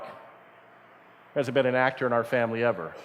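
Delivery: low, slow, dry and flat-out doubtful; slow dry deadpan reply. Retold or imitated. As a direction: let the news land with a dry thud. Dad answering son's actor wish.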